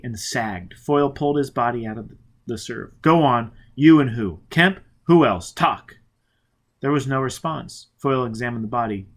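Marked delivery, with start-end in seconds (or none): 3.0-6.0 loud, pressing orders; harsh demand. Acted. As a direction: push him to talk. Foyle pressing the dying man.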